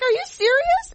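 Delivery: high, shocked squeal of disbelief. Retold or imitated. Shocked by hotel room invite.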